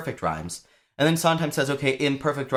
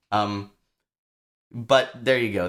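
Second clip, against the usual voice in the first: ordinary delivery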